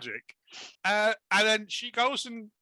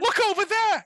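high, louder pointing cry; mock high exclamation; 5 dB louder than usual. Mocking the film, a high silly cry. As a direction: squeal it like a bad actor.

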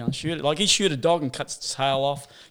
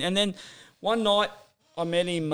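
ordinary delivery

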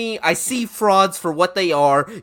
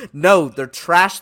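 louder and slow, each word landed; emphatic dismissal; 6 dB louder, slower than usual. Real, not acted. Host shooting down Kentucky hype, slow and loud. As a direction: slam the door on the hype.

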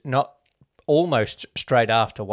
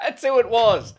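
higher, laughing as it comes back; amused realization; 10 semitones higher, 4 dB louder than usual. Real, not acted. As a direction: say it through laughter. Laughs on realising who it was.

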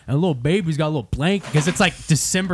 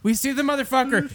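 normal talking voice

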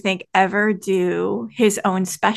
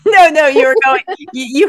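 laughing; 7 semitones higher, 8 dB louder, faster than usual. Real, not acted. Laughing at misunderstood question.

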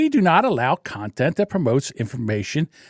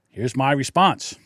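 ordinary delivery

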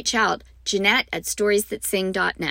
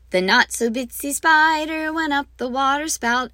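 medium spider; 6 semitones higher than usual. Shown as a demonstration. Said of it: "And I get louder as we go".

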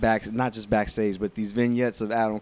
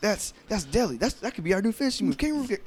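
high, fast goofy pitch; excited goofy partner; 10 semitones higher, faster than usual. Retold or imitated. Pitching the kangaroo kick to his partner, high goofy excitement. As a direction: pitch it like a silly excited sidekick. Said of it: "they were like".

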